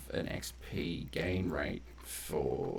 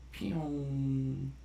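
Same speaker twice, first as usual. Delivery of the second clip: pew sound effect; 6 semitones higher than usual. Pretend. Levelling up skill.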